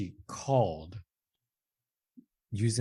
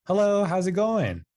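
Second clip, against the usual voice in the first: much higher, louder, bright doctor greeting; sudden friendly tone; 11 semitones higher, 6 dB louder than usual. Retold or imitated. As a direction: switch on a cheery bedside manner. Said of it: "completely changed his tone".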